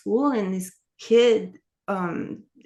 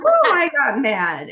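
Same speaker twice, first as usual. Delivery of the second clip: louder and higher, drawn out but less extreme; emphatic retelling of anger, slower; got mad; 7 semitones higher, 7 dB louder, slower than usual. Retold or imitated. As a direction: lean into it with an emphatic "Ooh". Restates the anger, firmer.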